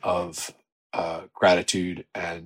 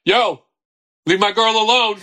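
high-pitched New York holler; imitated shout across the street; mock street call; 12 semitones higher, 4 dB louder than usual. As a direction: call it out like a protective passerby. Traffic officers calling out to him.

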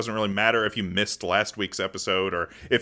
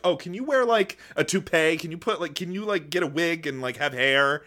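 higher-pitched mock request; imitating pushy producers; 7 semitones higher than usual. Producers asking their bald lead to change, higher-pitched mock coaxing. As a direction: plead lightly, like a fussy showrunner. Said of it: "And they were like".

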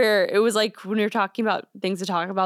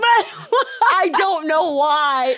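laughing; 7 semitones higher, 5 dB louder, much slower than usual. Real, not acted. Laughing at the nickname.

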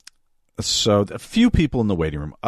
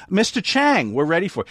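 higher-pitched, decisive summons; direct call; 7 semitones higher than usual. Acted. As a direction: call straight to the one person you mean. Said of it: "look at the one ... and go".

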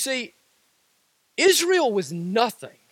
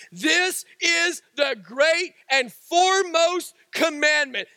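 emphatic shout; 4 semitones higher, slower than usual. Acted. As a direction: proclaim it like an exclamation point. Preacher repeats the verse, shouting.

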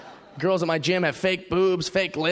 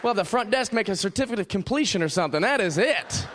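teasing gym buddy; 4 semitones higher than usual. Retold or imitated. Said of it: "be like".

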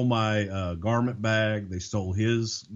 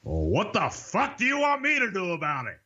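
higher, gruff annoyed boss voice; imitating angry Vince; 9 semitones higher than usual. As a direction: bark it back like a fed-up boss. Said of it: "I make that call".

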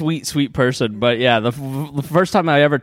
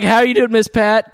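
much higher greeting, imitating oneself; high-pitched reply; 7 semitones higher than usual. Answering Miss Pat's early morning call, high-pitched reply. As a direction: answer the caller in a raised, lifted voice. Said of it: "I'm like".

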